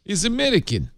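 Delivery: high-pitched put-on Russian accent; hammed-up Russian accent; mock Russian accent. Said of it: "done that with a Russian accent".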